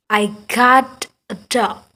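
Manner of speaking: "I got a dog" said slowly, falling at the end; slow demo statement, pitch dropping on "dog"; falling intonation. Shown as a demonstration. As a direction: let "I got a dog" settle downward like a statement. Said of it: "intonasi suara itu turun".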